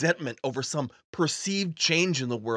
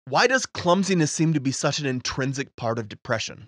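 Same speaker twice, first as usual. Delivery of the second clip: normal talking voice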